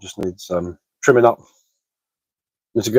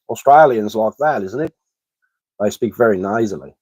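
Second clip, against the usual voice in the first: crude, nasal mock Australian accent; bad Australian accent. Shown as a demonstration. Streamer showing viewers how Australians talk, bad Australian accent. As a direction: put on a hammed-up Australian twang. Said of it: "That's a pretty crap Australian accent".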